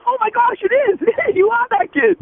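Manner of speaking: imitating the man's thrilled squeal of recognition; amazed excitement. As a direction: gush with delighted disbelief. Man recognizes the famous kid.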